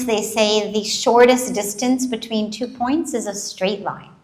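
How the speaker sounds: normal talking voice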